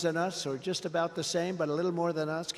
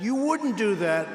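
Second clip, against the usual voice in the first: slow, higher, louder disbelief; mocking Putin; 5 semitones higher, 6 dB louder than usual. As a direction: drag out the scoff as Putin. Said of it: "He said".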